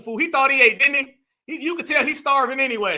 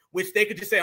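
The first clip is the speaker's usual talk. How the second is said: normal talking voice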